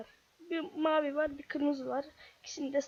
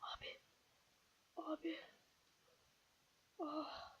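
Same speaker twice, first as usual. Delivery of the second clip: drained whisper; 21 dB quieter than usual. Real, not acted. Exhausted after the fight.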